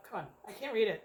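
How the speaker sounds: usual speaking voice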